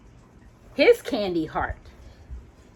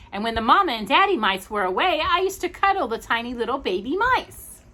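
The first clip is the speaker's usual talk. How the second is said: high, bright-hearted doll chatter; cheery doll voice; 4 semitones higher, faster than usual. Acted. Raggedy Andy telling the dolls about the mice, high cheery doll voice. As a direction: play the happy rag doll.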